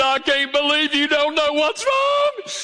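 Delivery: high, drawn-out wail; mock crying wife. Wife whose anniversary was forgotten, mock wailing at husband. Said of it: "you look up and she's crying".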